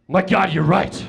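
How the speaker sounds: sudden horror at his own hands; panicked realization. Retold or imitated. Tripping guy to the girl, loud and alarmed.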